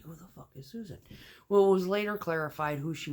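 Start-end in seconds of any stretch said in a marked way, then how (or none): 0.0-1.0 soft incredulous question; quiet disbelief; hushed caption line. Retold or imitated. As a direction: mutter it, baffled. Repeating video caption.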